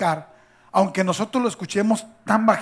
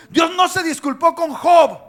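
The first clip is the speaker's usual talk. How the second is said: higher, louder preaching climax; fiery preaching; 7 semitones higher, 8 dB louder than usual. Acted. Preacher crying out to the church about Job's trials, shouting. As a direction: build it to a shouting peak.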